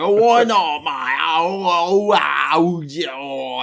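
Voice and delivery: put-on loud wailing Essex accent parody; mock Essex accent. Host mocks how his co-host from Essex sounds, wailing nonsense accent. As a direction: howl out a garbled Essex impression. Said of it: "that's what it sounds like to me".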